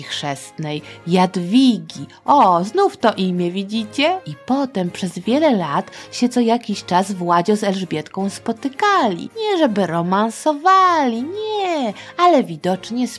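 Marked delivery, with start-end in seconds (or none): none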